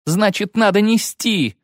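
high, urgent narrated outburst; agitated retort after cursing. A narrator's reading. Lyutik answers the witcher about carrying Milva, agitated. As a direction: throw it out high and heated. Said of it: "Лютик зверски выругался".

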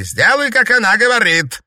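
very high and loud; shouted command. A narrator's reading. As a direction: bark the order. Villain commands the fortune teller.

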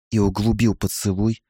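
plain reading voice

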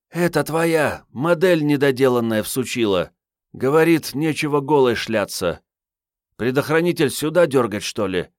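put-on a cheeky young woman talking. A narrator's reading. Marietta voiced by narrator.

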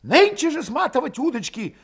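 high-pitched, urgent rallying; heated agitation. A narrator's reading. Valet urging everyone to flee today, fired up. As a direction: whip them up, high and heated. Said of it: "возбуждающе горячился Валет".

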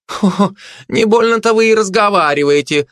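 high, scoffing gossip voice; high-pitched huffy grumble; muttering gossip. A narrator's reading. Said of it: "пробормотала сплетница".

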